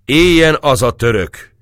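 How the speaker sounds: high, loud jesting cheer; a bold toast flung across the room; Gergely toasting at the feast; shouted toast. Acted. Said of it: "kiáltotta Gergely".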